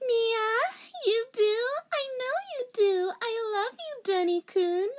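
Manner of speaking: put-on very high, sobbing-sweet girl voice; high cry-voiced anime girl; hammed-up swooning girl. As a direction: overact the teary heroine. Girl character.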